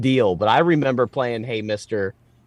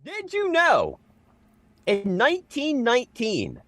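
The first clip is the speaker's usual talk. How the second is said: fun fact read; 6 semitones higher, slower than usual. Acted. Reading a fun fact aloud.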